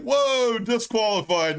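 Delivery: high, loud ringside call; excited fight-call; mock announcer shout. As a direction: yell it like a hyped announcer. Announcer calling the disqualification.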